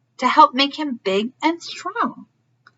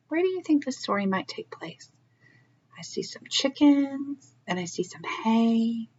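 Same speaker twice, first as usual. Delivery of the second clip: soft whisper; 8 dB quieter than usual. Real, not acted. Reader whispers a guess to the listening children. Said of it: "whispering to children".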